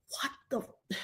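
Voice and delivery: hushed, acted disbelief; barely-there whispered shock; stunned whisper. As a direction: whisper it as if dumbstruck. Retelling her stunned reaction.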